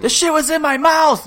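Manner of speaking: high-pitched, loud outraged yell; mock anger. Prank victim's outburst retold to co-hosts, mock anger. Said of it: "immediate anger now".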